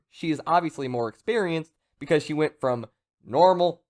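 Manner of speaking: ordinary talk, nothing marked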